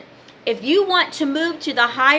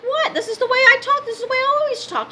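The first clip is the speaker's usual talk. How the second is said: high-pitched retold indignation; incredulous protest; 13 semitones higher, faster than usual. Answering people who object to how she talks, incredulous protest. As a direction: protest in disbelief, voice jumping way up. Retells protesting her way of talking.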